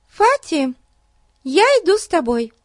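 put-on high, firm little girl; determined girl voice. A narrator's reading. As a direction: announce it firmly as a girl. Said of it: "решительно заявила она".

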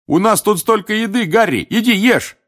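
loud, high, excited call; boisterous, high-pitched urging. Acted. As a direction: shout the invitation across a noisy party. Excited crowd calls hero to eat.

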